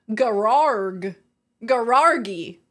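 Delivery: Gararg, Garargi, said with a hard g and a stretched middle; her own garage. Shown as a demonstration. Showing chat how she says garage, Garargi. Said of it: "That's how I say garage".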